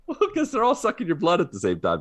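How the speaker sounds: high, fast, laughing explanation. Real, not acted. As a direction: giggle through the joke.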